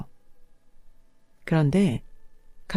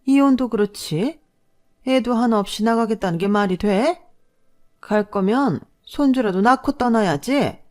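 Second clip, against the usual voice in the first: shrill, higher outraged mother-in-law yelling; shocked shout; 5 semitones higher, 3 dB louder than usual. A narrator's reading. Mother-in-law shouting at daughter-in-law over packed bags, outraged. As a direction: scold her daughter-in-law, voice rising in outrage. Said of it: "경악하며 소리쳤어요".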